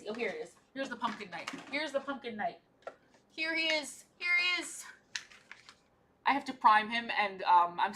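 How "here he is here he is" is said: high, playful toy voice; silly character voice. Pretend. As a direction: present the knight in a squeaky voice. Figure presented in put-on voice.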